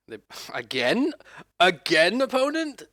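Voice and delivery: loud, high disbelieving cry; shocked disbelief. Real, not acted. Opponent fetched again, disbelief.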